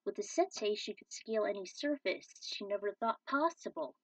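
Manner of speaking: ordinary delivery, nothing marked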